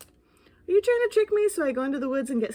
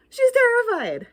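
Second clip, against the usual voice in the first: high, loud fake alarm; hammed-up dismay; mock alarm; 11 semitones higher, 7 dB louder than usual. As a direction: play up the cat's fright. Ghost blames reader for scaring cat.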